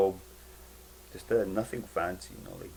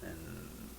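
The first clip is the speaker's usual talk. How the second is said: ordinary talk, nothing marked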